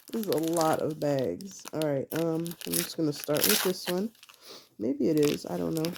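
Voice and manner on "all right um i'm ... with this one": usual speaking voice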